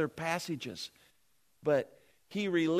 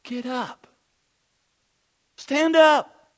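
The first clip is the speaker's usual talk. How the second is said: commanding Pilate; 9 semitones higher, 11 dB louder, slower than usual. Acted. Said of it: "Pilate says".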